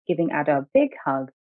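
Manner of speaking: ordinary delivery, nothing marked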